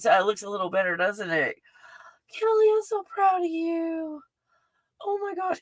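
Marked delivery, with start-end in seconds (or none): 2.4-5.6 very high, proud gush; high-pitched, overcome and softening; about to cry. Real, not acted. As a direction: squeal with pride, close to tears. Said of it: "I'm about to cry".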